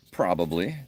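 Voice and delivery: ordinary talk, nothing marked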